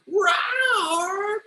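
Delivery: high, slow attempt at a roar; imitated roar. Host trying the alien's planet name, imitated high roar. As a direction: tentatively copy the roar, drawn out high. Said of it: "am I saying that right".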